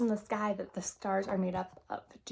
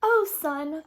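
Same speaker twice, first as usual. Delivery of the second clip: high, loud tearful plea; tearful cry; 7 semitones higher, 12 dB louder than usual. Acted. Girl sobbing to the sun for help, a mildly voiced high tearful cry. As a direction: call out to the sun as if near tears. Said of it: "the girl sobbed".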